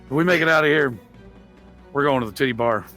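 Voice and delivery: usual speaking voice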